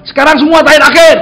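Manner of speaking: loud, high call to a crowd; calling out instruction. Retold or imitated. Preacher orders his congregation to sit, calling out loudly. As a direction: call the command out to everyone.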